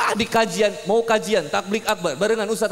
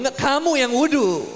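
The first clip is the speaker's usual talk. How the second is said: high, escalating mock-angry comeback; heated retort; 4 semitones higher than usual. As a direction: fire it back as the spat peaks. Retold quarrel escalating to loud retort. (bantahan sengit bernada tinggi)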